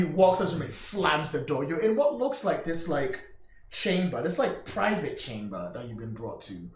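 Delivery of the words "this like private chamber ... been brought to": ordinary talk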